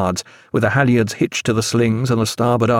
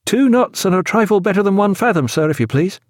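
raised-pitch seaman reporting to his captain; formal report; 9 semitones higher than usual. A narrator's reading. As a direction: report up respectfully in a high, carrying voice. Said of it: "he reported bareheaded to the captain".

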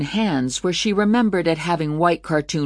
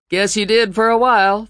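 higher, louder TV pitchman voice; infomercial voice; 6 semitones higher, 5 dB louder than usual. Acted. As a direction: sell it like a late-night infomercial host. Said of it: "the voice said".

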